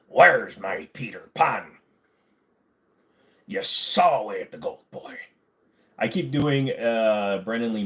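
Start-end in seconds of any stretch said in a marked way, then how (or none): 0.1-5.2 slow, louder pirate growl of Captain Hook. Pretend. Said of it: "Yours would be Captain Hook".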